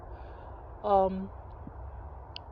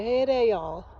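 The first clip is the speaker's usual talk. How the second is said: aunt's hearty Mississippi welcome, louder and lifted; Mississippi accent; 3 semitones higher, 4 dB louder than usual. Retold or imitated. Aunt calling visiting family in from the car, hearty Mississippi accent. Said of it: "Mississippi accent, she said".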